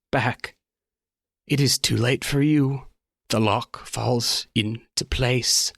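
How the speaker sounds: slow, measured conductor voice; lightly voiced conductor, drawing out each phrase; conductor's pronouncement. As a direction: pronounce it slowly, with weighty pauses. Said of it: "looking at Bailey, says".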